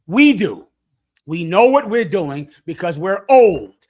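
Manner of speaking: loud, firm preaching; forceful declaration. Real, not acted. Elder telling the young couples, loud and firm. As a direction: proclaim it with full authority.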